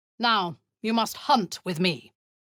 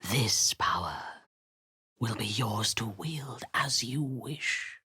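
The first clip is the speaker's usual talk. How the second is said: soft and much lower, luring; seductive whisper; 6 semitones lower, 5 dB quieter than usual. Acted. Tempting an ally with power, in a low whisper. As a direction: tempt them in a hush.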